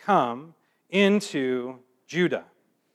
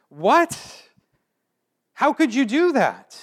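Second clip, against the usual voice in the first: much higher, incredulous "What?"; a prophet stunned by God's plan; Habakkuk reeling at God's answer, shocked and high; shocked disbelief; 8 semitones higher, slower than usual. Retold or imitated. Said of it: "He was surprised".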